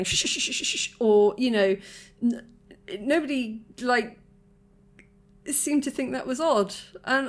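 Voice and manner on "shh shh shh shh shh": shushing. Retold or imitated. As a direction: hush a crying child. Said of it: "nobody was running over going".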